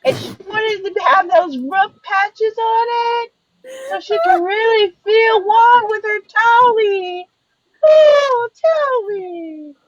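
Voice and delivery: high whiny crying voice. Retold or imitated. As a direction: babyish sob-talk.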